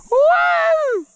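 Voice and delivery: very high, loud whoo of joy; triumphant whoop. Real, not acted. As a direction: let out a huge victory whoop. Celebrating again.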